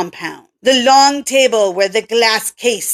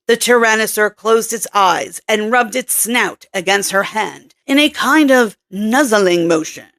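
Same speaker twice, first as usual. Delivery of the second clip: normal talking voice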